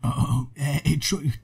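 usual speaking voice

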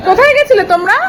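put-on high, loud sing-song baby talk. Mother asking the baby what she eats, high baby talk. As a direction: chirp at the baby over her watermelon.

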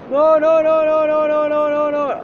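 high, loud rapid no's; panicked no's. Real, not acted. Player panicking as enemies close in.

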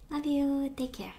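normal talking voice

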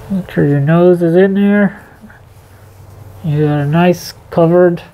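muffled behind respirator; speaking through filter mask; through a face mask. Real, not acted.

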